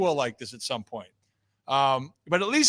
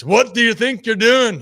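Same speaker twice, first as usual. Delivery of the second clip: loud, higher mock accent that slips into Irish; failed Spanish accent; 6 semitones higher, 8 dB louder than usual. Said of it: "so it came out like this".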